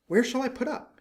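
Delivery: a small bird, peeved and pouting; little swallow. A narrator's reading. Swallow voiced in story.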